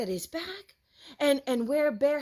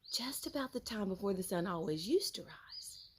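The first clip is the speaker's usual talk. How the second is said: hushed narration; 3 semitones lower, 8 dB quieter than usual. A narrator's reading. Hushed storytelling.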